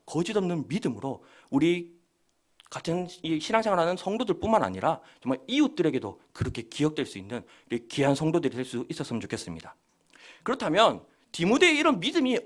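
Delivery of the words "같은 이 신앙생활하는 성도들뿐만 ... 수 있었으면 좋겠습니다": normal talking voice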